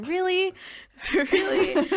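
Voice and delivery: high, loud incredulous really; mock offended. Told she is too American to get it, incredulous. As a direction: play it wounded and disbelieving. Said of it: "And I'm just like".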